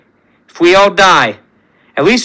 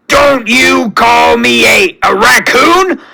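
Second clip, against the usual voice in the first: "a raccoon?" thrown back higher and louder in outrage; offended outburst; 7 semitones higher, 3 dB louder, slower than usual. Acted. Rocket offended by the word.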